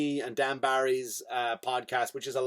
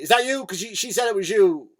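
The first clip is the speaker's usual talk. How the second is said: loud, high questioning; hammed-up police interrogation; accusing cop; 5 semitones higher, 7 dB louder than usual. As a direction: confront the suspect, voice raised. Acted cop confronting the suspect.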